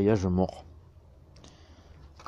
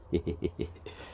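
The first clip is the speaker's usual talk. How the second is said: petit rire soufflé en saccades; 3 semitones lower, 9 dB quieter than usual. Real, not acted. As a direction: rire doucement, par à-coups. Amusé par sa propre remarque. (petit rire soufflé)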